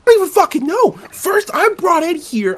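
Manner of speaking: high-pitched, bewildered complaining; exasperated rant. Acted. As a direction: protest like a baffled suspect. Acted rant of bewildered suspect.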